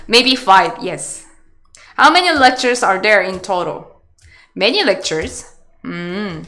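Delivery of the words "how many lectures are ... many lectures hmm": ordinary delivery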